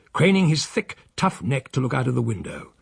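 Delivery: ordinary delivery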